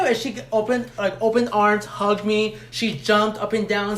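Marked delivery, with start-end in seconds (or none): none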